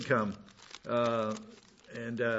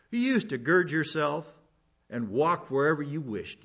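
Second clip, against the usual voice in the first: ordinary talk, nothing marked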